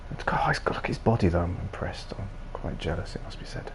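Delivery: soft whispered admiration. Real, not acted. Gamer eyeing a muscular character, whispering. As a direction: whisper it, half jealous.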